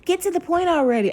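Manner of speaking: high, fast mocking of a whiny commenter; impatient viewer. Mocking impatient commenters who complain about her chatting. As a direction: mimic an annoyed viewer demanding she hurry. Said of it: "people get all upset".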